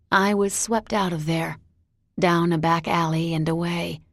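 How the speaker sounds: plain reading voice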